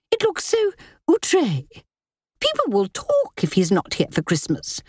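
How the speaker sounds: high, snappish society lady; haughty sister-in-law. Acted. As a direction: press with sharp impatience. Linda complains about Giles.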